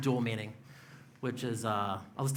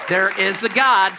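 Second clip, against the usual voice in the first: proclaiming; 6 semitones higher, 11 dB louder than usual. Real, not acted. Sober twenty years, exclamation.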